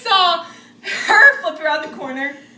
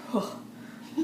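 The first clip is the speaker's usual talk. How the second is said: relieved whew; 17 dB quieter than usual. Retold or imitated. Friends bracing at the haunted house, a whispered whew.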